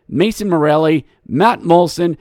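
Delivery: ordinary talk, nothing marked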